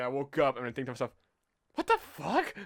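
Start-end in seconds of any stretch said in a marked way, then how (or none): 1.7-2.5 high, loud mock disbelief; bewildered outburst. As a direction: exclaim it in baffled shock, voice shooting up. Retold bewildered waking thought.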